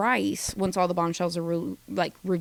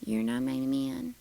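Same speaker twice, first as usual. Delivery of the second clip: mock quiet; 8 semitones lower, 5 dB quieter than usual. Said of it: "just goes".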